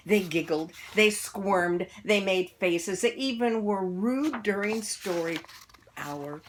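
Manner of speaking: usual speaking voice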